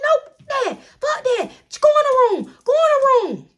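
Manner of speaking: high, loud furious command; mom yelling. Retold or imitated. Mom ordering daughter to her room, high and loud. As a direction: bark the order as the raging mom. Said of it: "She like".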